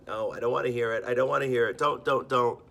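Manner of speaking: put-on loud high childish protest, I don't wanna hear it; covering ears, won't listen. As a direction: shout it like a kid plugging his ears. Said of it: "and doing the".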